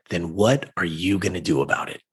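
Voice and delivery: low, measured question; low challenge. Retold or imitated. Mom challenging her son in the car, low and measured. Mom's challenge, first take.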